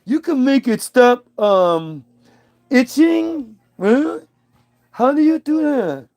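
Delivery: high, wide-eyed goofy voice; naive Adam voice. Acted. Adam gasping at the snake's offer, high goofy voice. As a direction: play Adam as a dim, eager man-child.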